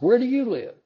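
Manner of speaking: high, loud, panicked blurt; flustered blurt. Acted. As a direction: blurt it out, caught off guard. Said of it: "one of them blurts out".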